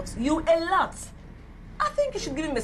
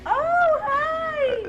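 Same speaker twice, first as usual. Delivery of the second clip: high drawn-out squeal of greeting; surprised greeting; 12 semitones higher than usual. Acted. Unexpected visitor greeted at table.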